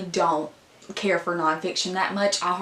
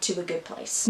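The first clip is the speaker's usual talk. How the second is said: ordinary delivery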